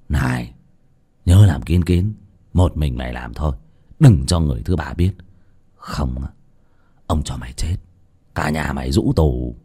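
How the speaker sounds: trầm, nhỏ, lạnh lùng hăm dọa; dọa khẽ mà nặng; thấp giọng đe dọa. Acted. (cụ Lý gọi giật lại, hạ giọng dọa thằng Tý)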